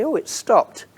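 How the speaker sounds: ordinary talk, nothing marked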